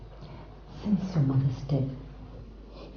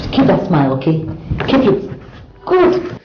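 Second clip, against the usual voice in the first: loud, bright coaxing; aunt cheers up her niece, loud and upbeat; cheerful encouragement; 10 dB louder than usual. Acted. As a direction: egg her on to keep smiling.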